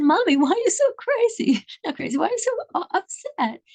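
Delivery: put-on high childish voice asking his mom; little boy. Small boy asks his upset mother, in a high childish voice.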